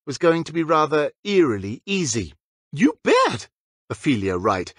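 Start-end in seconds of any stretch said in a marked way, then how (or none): 2.7-3.5 high, bright pixie captain; chirpy and eager; perky girl voice. A narrator's reading. Ophelia cheerfully agreeing to help.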